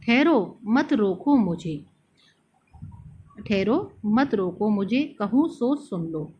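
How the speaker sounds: slow, pleading verse recital; poem recital. Shown as a demonstration. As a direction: recite it as Kaikeyi's plea. Recites Kaikeyi's lines, quoted. (Kaikeyi की विनती, धीमे काव्य पाठ में)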